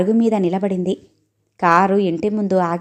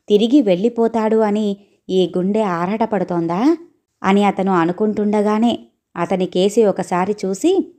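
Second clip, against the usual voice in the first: plain reading voice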